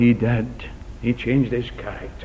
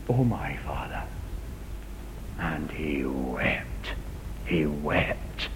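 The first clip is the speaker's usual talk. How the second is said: soft, low, unvoiced whisper; slow whispered sorrow; whispered grief; 9 semitones lower, 8 dB quieter, much slower than usual. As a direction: let it die to a broken whisper. Esau's weeping.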